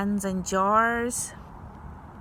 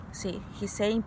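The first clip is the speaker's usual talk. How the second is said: ordinary delivery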